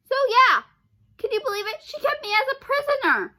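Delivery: high, loud indignant complaint; high-pitched indignant rant. Real, not acted. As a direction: complain in outrage.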